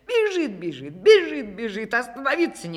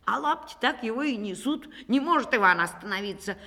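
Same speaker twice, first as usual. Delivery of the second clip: ordinary delivery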